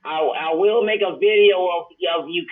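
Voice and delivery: ordinary talk, nothing marked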